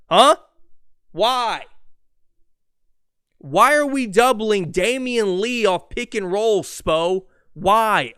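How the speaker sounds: imitated frustrated shout. Jimmy Butler yelling at his coach about the defense, frustrated shout. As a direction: yell at the coach in disbelief. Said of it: "He's like".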